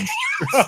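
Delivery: sudden high laughing yelp; burst of laughter. Real, not acted. Laughing at the debate memo.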